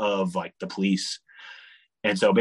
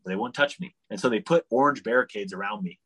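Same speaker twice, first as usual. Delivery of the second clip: usual speaking voice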